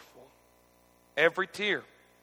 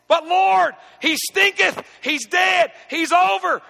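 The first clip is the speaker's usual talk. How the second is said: loud, high, pleading protest; shouted complaint; 11 semitones higher, 7 dB louder, slower than usual. Acted. Preacher voicing Martha, shouting her objection to Jesus. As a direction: cry it out at full voice, high.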